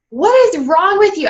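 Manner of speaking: high, punched retold outburst; exasperated demand. Husband confronts wife about crying baby, exasperated. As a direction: imitate a husband at his wits' end, pitch leaping.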